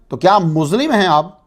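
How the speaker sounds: usual speaking voice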